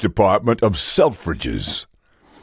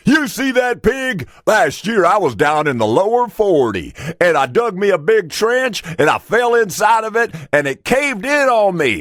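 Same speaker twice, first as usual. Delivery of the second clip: high-pitched, animated farmer spinning a tall tale; excited farmer's yarn, stretched at the end; 9 semitones higher than usual. Acted. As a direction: tell it like a proud country farmer. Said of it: "and the farmer says".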